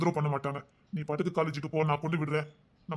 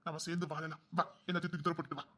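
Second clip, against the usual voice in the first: much softer, gentle plea to come along; soft coaxing; 13 dB quieter than usual. Acted. Husband softly coaxing his wife to come live together, quiet plea. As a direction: coax her home almost under your breath.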